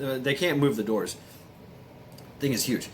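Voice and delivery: usual speaking voice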